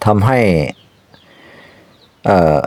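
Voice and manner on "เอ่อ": plain reading voice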